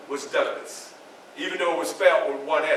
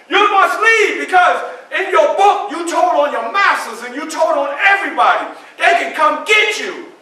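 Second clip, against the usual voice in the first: loud, high, insistent shouting; urgent shouted warning; 10 semitones higher, 8 dB louder than usual. Acted. Mister Garrison warning Frederick about his book, shouting urgently. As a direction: press the warning hard at full voice. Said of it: "he just burst through the door".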